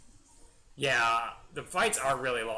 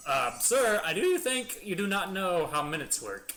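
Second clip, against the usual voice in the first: mock underling; 7 semitones higher, 4 dB louder than usual. Underling answers Frieza in the skit.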